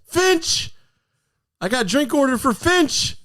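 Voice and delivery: high-pitched mock bartender yell; bartender's yell. Bartender calling out a drink order, high yelling. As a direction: yell it out like a bartender over the bar. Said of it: "the bartender sell-, yells".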